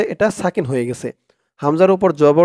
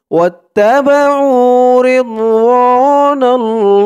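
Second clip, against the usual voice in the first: slow, higher sung recitation; melodic recitation; 8 semitones higher, 6 dB louder, much slower than usual. Shown as a demonstration. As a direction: chant it like a qari.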